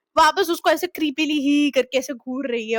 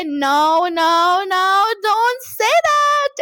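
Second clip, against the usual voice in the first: rising, drawn-out, louder no no no; dramatic denial; 3 semitones higher, 3 dB louder, much slower than usual. Retold or imitated. Girl protests at the restaurant table, hammed-up. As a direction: mimic the famous overdramatic outburst. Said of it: "वो वाला dialogue".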